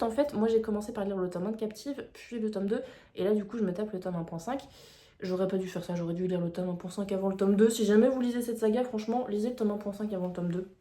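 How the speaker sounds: ordinary talk, nothing marked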